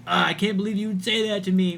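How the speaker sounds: mock a wounded chatter; hammed-up hurt whine; whiny mock. Mocking the upset chatter again.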